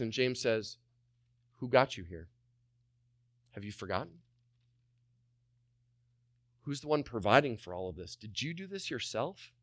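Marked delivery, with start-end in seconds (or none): 1.6-9.5 soft, probing questions with long pauses; quiet challenge. Acted. James asking the comfortable who provided, quietly challenging. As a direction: question them softly, letting each one land.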